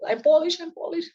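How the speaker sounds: high-pitched panicked plea, acted; acted high cry of protest; pleading pianist. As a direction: play a pianist desperately identifying himself to soldiers. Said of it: "Cuando llegan los rusos y dice".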